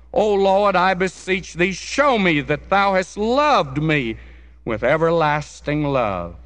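high, loud plea that settles at the end; strong cry. A narrator's reading. Bunyan beseeching the Lord for love, strong pleading cry. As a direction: cry it out as a fervent prayer. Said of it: "cried to him in these words with strong cries".

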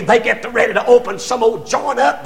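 ordinary delivery

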